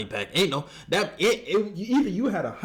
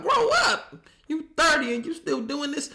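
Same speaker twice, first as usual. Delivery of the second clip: incredulous outburst; 11 semitones higher than usual. Real, not acted. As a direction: shriek in disbelief at him. Disbelief at the storyteller.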